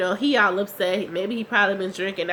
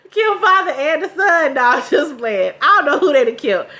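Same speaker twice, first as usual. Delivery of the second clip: laughing loudly; 6 semitones higher, 7 dB louder than usual. Real, not acted. Laughing after joke.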